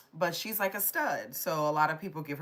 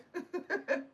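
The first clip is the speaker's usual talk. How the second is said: short high laugh. Real, not acted. Laughs at a chat comment.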